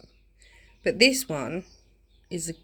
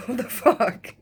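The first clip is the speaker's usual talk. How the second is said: laughing disgust; 4 dB louder, slower than usual. Retold or imitated. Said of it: "I was like".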